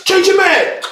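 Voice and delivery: mock video game soldier shouting a reload warning; game voice callout. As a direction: yell it like a video game squadmate. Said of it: "You heard the".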